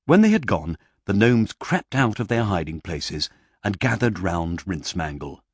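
ordinary delivery

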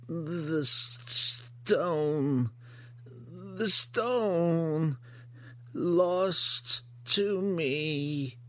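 high, very slow mournful monster; grotesque monster voice. Acted. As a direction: grieve the lost stone slowly. Hookar mourns the stone.

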